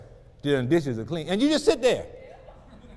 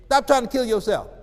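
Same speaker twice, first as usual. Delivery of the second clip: ordinary delivery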